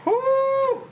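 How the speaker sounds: very high, loud victory "woo"; triumphant whoop. Real, not acted. Player finishing the timed puzzle, whooping in triumph. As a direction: let out a high celebratory whoop.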